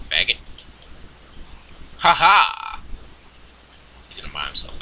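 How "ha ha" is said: loud, high "ha ha" crow; two-beat laugh, punched out high and loud; triumphant laugh. Real, not acted. Player crowing over the game, triumphant laugh.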